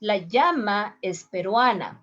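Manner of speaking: llama said as "yama"; llama with a y sound; first way. Shown as a demonstration. Teacher demos llama with a y. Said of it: "listen my pronunciation".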